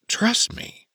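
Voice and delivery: breathy whisper, high; whispered reassurance. Acted. Mouse voiced reassuring.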